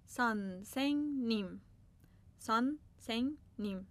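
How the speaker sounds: slow, careful سانسنگنیم, each syllable clear; Korean word for teacher. Shown as a demonstration. Teacher models a Korean word for her class, slowly.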